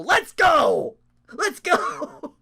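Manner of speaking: loud, high hype shout; excited cheer. Real, not acted. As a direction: cheer the spot on like a fan. Hyping a wrestling spot.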